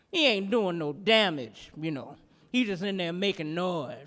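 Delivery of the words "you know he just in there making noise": normal talking voice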